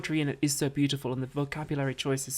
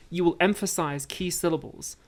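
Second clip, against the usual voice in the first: ordinary talk, nothing marked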